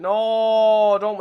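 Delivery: sharp loud no at the screen; protesting yell; frustrated shout. Real, not acted. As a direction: cry out in dismay. Reacting to opponent's play.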